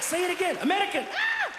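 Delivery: high, giddy shout ending in a squeal; excited. Retold or imitated. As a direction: beg to hear it, thrilled and high. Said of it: "I was excited".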